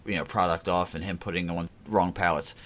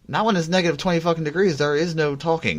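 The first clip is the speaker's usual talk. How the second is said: fast, higher, emphatic; heated; 9 semitones higher, 6 dB louder than usual. Real, not acted. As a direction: fire it off fast and worked up. Heated defense of threats.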